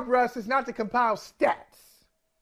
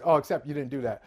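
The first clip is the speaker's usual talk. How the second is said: ordinary talk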